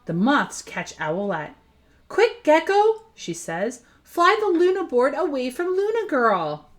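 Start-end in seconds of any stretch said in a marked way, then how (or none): 2.1-6.7 urgent. Acted. Urgent instruction in danger.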